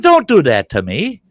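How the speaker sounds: high, loud protest; retold indignant scolding. Husband scolding the little man in the office, loud indignation. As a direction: act out a loud telling-off.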